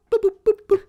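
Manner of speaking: high, bouncy boops; imitated knock. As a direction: tap out the secret knock with your voice. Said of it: "secret knock that sounds like boop".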